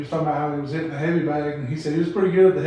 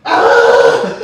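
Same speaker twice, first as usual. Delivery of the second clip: mock T-rex roar, loud and low; angry T-rex roaring; dinosaur roar; 13 semitones lower, 14 dB louder than usual. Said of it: "He's like".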